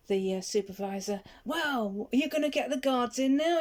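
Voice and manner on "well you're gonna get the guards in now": higher, louder mock defiant yell; retold shout back. Young lad shouting back at the supervisor, mock yelling. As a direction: throw it back at her, cocky. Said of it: "he shouted back at the supervisor".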